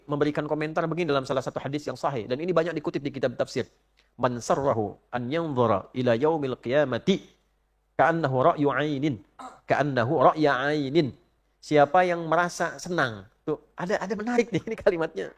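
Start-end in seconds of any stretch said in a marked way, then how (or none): 4.1-11.1 slow chanted Arabic; hadith recitation. Acted. Preacher quoting the Prophet's hadith, slow chant. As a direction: recite the hadith slowly, repeating the end.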